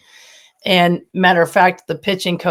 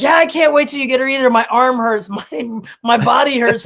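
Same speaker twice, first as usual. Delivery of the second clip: weary whining; 6 semitones higher than usual. Retold or imitated. Mother retelling her tired reply.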